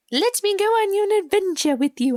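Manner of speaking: high-pitched imitation of an eager child; put-on high kid voice, eager; eager little kid. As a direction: plead like a spunky little kid. Voices the kid Maisie pleading.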